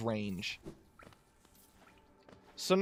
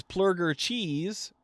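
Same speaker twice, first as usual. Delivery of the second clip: ordinary talk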